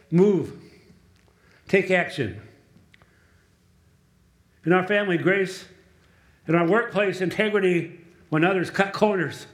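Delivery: rising preaching. Acted. Pastor urging his church to act, preaching with force. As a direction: drive the charge home to the pews.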